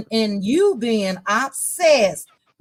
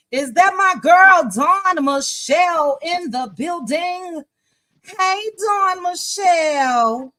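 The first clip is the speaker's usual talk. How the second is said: excited greeting; 6 semitones higher, 3 dB louder, slower than usual. Real, not acted. Spots friend joining the live.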